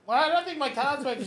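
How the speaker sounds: high bewildered voice; puzzled. Retold or imitated. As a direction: puzzle over the failing card. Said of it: "I was like".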